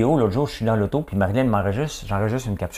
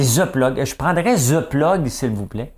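mock French accent saying THE as "ze"; put-on French accent, higher-pitched on "The Plug"; French person mimicked; 5 semitones higher, 3 dB louder, slower than usual. Quebec host teasing the French about English names, mock French accent. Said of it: "les Français disent tout le temps des The".

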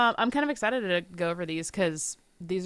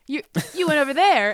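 English inflection; 6 semitones higher, 5 dB louder than usual. Retold or imitated. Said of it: "He does this inflection in his voice".

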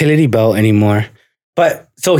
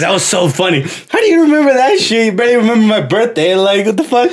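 dad teasing, pitched well up and quick; father mocks his grown son, high and fast; 7 semitones higher than usual. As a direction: needle him like a laughing father. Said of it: "he's like".

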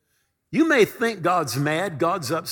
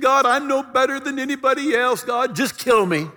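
a higher, pleading voice of a man who wants to give up; despairing prophet; 6 semitones higher than usual. Acted. Preacher voicing Elijah crying out to God, higher and pleading. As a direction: plead like a prophet at the end of his rope.

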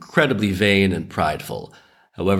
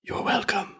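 much higher, soft mock whisper; 13 semitones higher, 6 dB quieter than usual. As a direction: breathe it smugly into his ear. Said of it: "Ben whispers in his ear".

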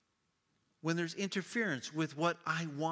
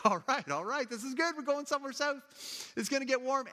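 high, chirpy relief; relieved; 11 semitones higher, 3 dB louder than usual. Retold or imitated. Mom pleased the back roads are working, high and chirpy. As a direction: cheer up as traffic moves. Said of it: "And she's like".

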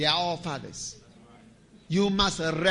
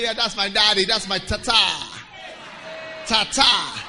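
put-on proud child; 3 semitones higher, 7 dB louder than usual. Child proudly owning father.

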